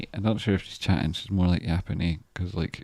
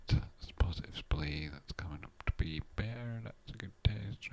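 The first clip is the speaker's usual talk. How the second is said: whisper; 7 dB quieter than usual. Real, not acted. Reading chart, whispering.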